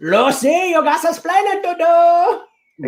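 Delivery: put-on high Cuban accented sitcom husband; [name]. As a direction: scold with a Cuban accent, slowly. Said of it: "That sounds like Skeletor De-, [name]".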